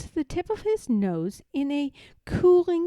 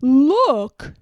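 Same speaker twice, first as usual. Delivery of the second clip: loud excited Pooh cry; 3 semitones higher, 12 dB louder than usual. A narrator's reading. Pooh calls Piglet's attention, loud and excited. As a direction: point and call it out. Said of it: "pointed excitedly".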